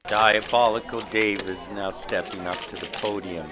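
live news-style commentary; reporter play-by-play. Acted. Reporter describing the villain's entrance, live. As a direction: describe him like breaking news.

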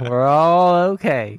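put-on Texas accent. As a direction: stretch it out like a cheery Texan on the radio. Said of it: "I keep the accent when I say that".

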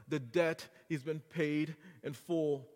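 ordinary talk

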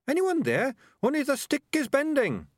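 high-pitched small creature calling for help; tiny voice, urgent and high; little voice. A narrator's reading. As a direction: pipe up from a tight spot. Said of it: "said the voice".

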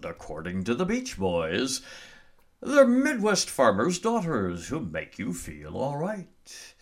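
big radio announcer sign-off. Acted. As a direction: sell it like an old-time radio host.

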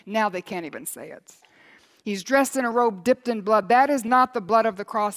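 loud, high fervent preaching; thundering revival preacher; preaching at full force. Acted. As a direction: build the congregation to a roar.

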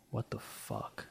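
unvoiced, stunned whisper. Real, not acted. Seeing the dream smoke in his room, whispered shock. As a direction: breathe it out in disbelief. Sees a strange blue hue.

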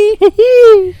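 laughing. Real, not acted. Laughing after mocking.

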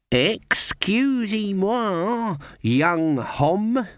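high, slow quavery granny act; putting on a feeble old lady voice; frail old woman. Acted. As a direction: play the harmless old dear. Granny plays a bent old woman.

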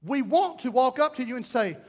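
ordinary delivery

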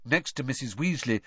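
ordinary delivery

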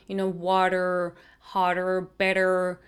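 slow American r-coloured water; American accent on r-endings. Shown as a demonstration. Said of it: "aprendí es al americano".

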